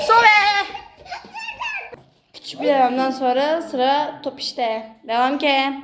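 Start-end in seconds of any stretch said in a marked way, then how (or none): 0.1-0.7 shout. Real, not acted. Tagging the base.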